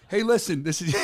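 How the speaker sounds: normal talking voice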